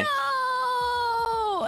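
long, high, drawn-out "no"; high anguished cry, played for comedy; mock despair wail. Retold despair at the poisoning.

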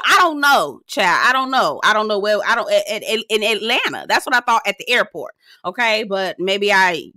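ordinary talk, nothing marked

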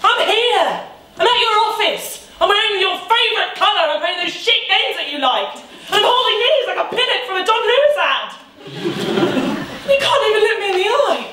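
high, strained outburst at an ex; exasperated outburst. Acted. Jilted ex confronting Luke at his office, exasperated outburst. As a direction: plead indignantly, pitch soaring far above normal.

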